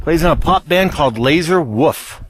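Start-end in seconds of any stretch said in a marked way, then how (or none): 1.6-2.2 "Wolf" in a Pittsburgh accent. Real, not acted. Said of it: "that's the Pittsburghian in me. That's how we say wolf".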